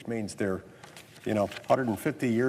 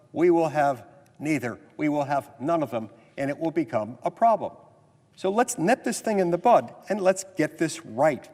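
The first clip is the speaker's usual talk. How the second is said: ordinary talk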